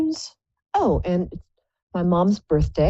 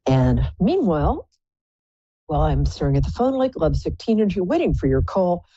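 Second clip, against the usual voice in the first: ordinary talk, nothing marked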